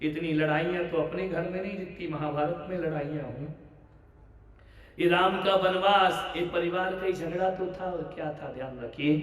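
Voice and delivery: ordinary talk, nothing marked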